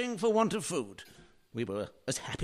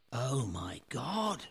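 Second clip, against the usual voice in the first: ordinary delivery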